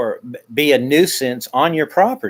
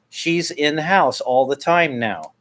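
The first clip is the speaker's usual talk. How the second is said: ordinary delivery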